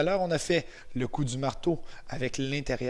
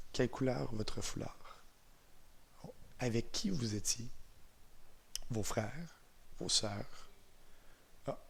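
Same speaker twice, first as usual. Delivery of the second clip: guided visualization; 6 dB quieter than usual. Real, not acted. Instructor guiding eyes-closed memories of first snow, calm and unhurried. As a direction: ease them softly into a memory.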